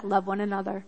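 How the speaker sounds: Jesus cutting through the chatter, calm; calm simple command. Retold or imitated. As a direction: settle it down and say it simply. Jesus voiced as calm short command.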